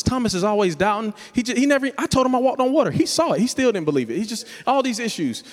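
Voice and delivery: hammed-up gossiping disciple; mock petty Peter. Peter gossips about Thomas, acted.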